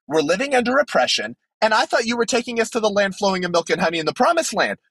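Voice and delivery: high, indignant rant at an absent God; exasperated Gideon. Pretend. Gideon complaining to God about oppression, high and exasperated. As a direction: whine it up high, fed up and accusing. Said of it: "I'd be like".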